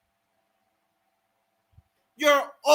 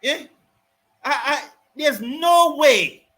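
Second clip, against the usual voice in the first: ordinary talk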